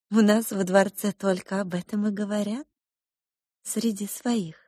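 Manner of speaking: lightly voiced cook mother. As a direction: explain gently to a child. Cook mother confides rumours.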